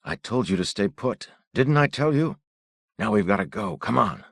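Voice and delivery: a worried father snapping at his son; stern. A narrator's reading.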